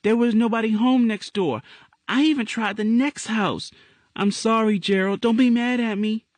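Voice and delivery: put-on high child's voice, anxious and apologetic; a small girl afraid of being scolded; high, worried girl's voice; little girl Angel. A narrator's reading.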